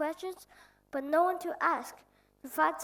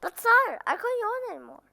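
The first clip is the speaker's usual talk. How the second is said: louder, higher plea; startled protest; 5 semitones higher, 8 dB louder than usual. Acted. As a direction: blurt it out in dismay. Prince protests to the king.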